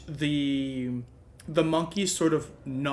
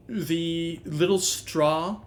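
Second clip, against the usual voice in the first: normal talking voice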